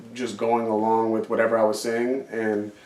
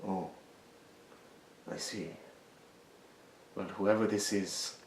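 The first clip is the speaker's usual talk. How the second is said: Taken line impression; 2 semitones lower, 12 dB quieter than usual. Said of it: "and just went".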